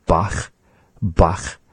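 "Bach" ending in a back-of-mouth rasp; raspy final CH. Shown as a demonstration. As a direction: scrape the end of "Bach". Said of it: "the way that they say it".

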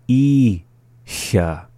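"Icha" split, long "I", pause, then "cha"; slowly. Shown as a demonstration. Teacher giving word examples, "Icha" said slowly in syllables. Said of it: "the second time slowly".

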